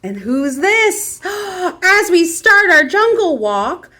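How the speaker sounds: ordinary delivery, nothing marked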